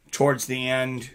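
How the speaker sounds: usual speaking voice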